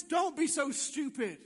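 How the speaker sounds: forceful, emphatic scolding; loud put-down. Acted. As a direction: shout down the dreamer. Lie scolds the would-be witness.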